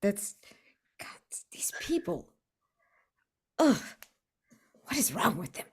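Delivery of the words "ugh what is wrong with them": normal talking voice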